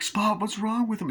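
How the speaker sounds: high imitated kid's alarm; alarmed child. Boy seeing his dog come back, high mock-alarmed voice. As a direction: act out a boy worried by his pet.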